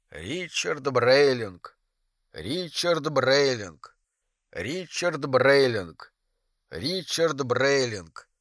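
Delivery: slow, soft, looping like a stuck record. Acted. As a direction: repeat the name as a jammed recording fading. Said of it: "Пластинку заело". (медленно и тихо, как заевшая пластинка)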